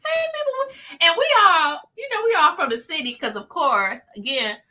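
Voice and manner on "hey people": imitating her friend's sunny hello; bubbly greeting. Friend greets coworkers, mimicked in a high bright hello. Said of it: "She's like".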